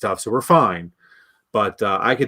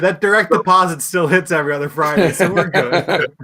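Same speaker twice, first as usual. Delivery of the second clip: laughing mock Stafford; 6 semitones higher, 4 dB louder than usual. Voicing Stafford's attitude.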